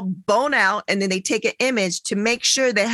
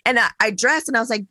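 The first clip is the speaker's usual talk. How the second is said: ordinary delivery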